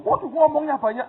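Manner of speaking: high, very loud mock dismay; acted loud exclamation. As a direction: blurt it out in put-on exasperation. Acted exclamation in retold exchange. (seruan kaget yang dibuat-buat, keras dan tinggi)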